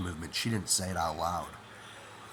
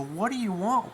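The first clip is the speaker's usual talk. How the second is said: calling out; 13 semitones higher than usual. Retold or imitated. Confronting intruder in dark.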